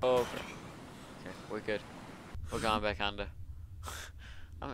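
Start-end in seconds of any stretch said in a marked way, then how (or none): none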